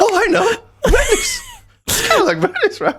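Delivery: very high, squeaky imitation; hammed-up high-pitched awkwardness; mock panicked voice. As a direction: exaggerate a friend's flustered squeak. Friend mimics the storyteller's panic.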